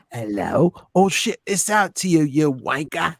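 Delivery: put-on Cockney accent; mock-British surprise at the door. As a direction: play it as a hammy Londoner. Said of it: "That was the best British accent".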